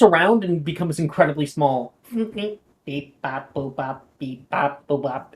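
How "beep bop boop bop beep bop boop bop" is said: robot toy beeps. Acted. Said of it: "It's saying as it's, like, moving around".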